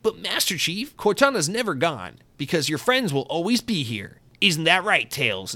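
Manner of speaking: high, upbeat cartoon-hero voice; Sonic. Host imagines Sonic consoling Master Chief, high cartoon-hero voice. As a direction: pitch it up like a heroic game mascot. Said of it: "I wanna see Sonic be like".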